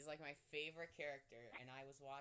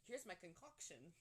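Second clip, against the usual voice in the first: lightly imitated cartoon accent, pitched up; a hint of foreign accent, higher; Oaken's accent; 5 semitones higher than usual. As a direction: have a rough go at a shopkeeper's accent. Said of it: "With that accent, I'm not very good at".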